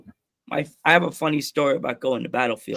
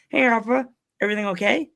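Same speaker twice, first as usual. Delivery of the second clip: higher, mock-nervous checking in; 7 semitones higher than usual. Joe walking over to the wrestler, mock nervous. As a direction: retell a tentative, anxious question up high. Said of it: "went from normal Joe to nervous".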